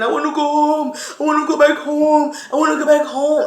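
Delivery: begging to go home, voice lifted; pleading to go home. Retold or imitated. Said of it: "I kept saying".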